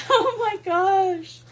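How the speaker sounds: high, slow laughing gasp of joy; teary laugh. Real, not acted. Opening a friend's gift, she laughs near tears. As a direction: laugh through happy tears. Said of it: "I'm gonna cry".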